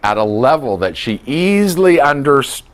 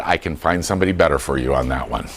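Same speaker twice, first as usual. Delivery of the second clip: quick aside; 9 semitones lower, 5 dB quieter, faster than usual. Shown as a demonstration. Deflecting God questions.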